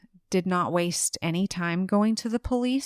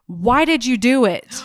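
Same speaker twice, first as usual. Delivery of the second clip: loud, raised accusing shout; retold shout; 5 semitones higher, 9 dB louder than usual. Detective jumps up to confront the husband, imitated shout. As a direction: shout it like an interrogator cornering a suspect. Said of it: "he stands up and he shouts".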